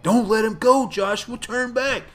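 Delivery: hammed-up cry of warning; mock urgent warning. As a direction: call it out like a pleading movie grandpa. Voices grandpa's urgent warning.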